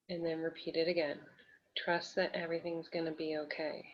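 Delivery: soft, calm guiding voice with long pauses; guided breathing exercise. Real, not acted. Coach guides nervous client to breathe and feel safe, soothing. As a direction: lead them gently through affirmation and breath, softly.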